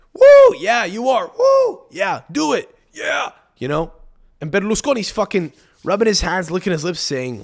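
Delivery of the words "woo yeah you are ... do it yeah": much higher, loud whooping cheer; mock cheering men. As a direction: whoop like a rowdy crowd of guys.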